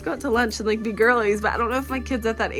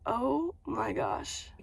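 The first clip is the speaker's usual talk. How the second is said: awed hush; 2 semitones higher, 10 dB quieter, much slower than usual. Retold or imitated. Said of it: "And I said".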